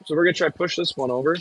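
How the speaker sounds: ordinary talk, nothing marked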